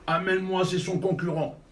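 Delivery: loud on purpose. Acted. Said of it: "il a dit à haute voix".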